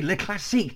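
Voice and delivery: "Le Classique" said the French way, no English s; French pronunciation. Shown as a demonstration. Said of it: "that's French for the classic".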